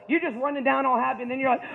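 usual speaking voice

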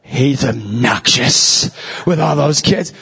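low, slow disapproving mutter; mock a judgy stranger; lightly voiced sniffy stranger; sniffy New Yorker. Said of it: "they're like".

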